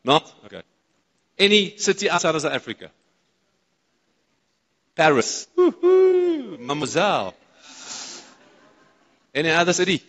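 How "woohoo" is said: high, drawn-out woohoo; teasing high "woo-hoo" cheer; playful whoop. Pretend. Cheers playfully at mention of Paris.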